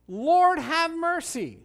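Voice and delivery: high, loud beggar's cry. Retold or imitated. Preacher voices the blind beggars, loud high cry. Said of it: "they're crying out".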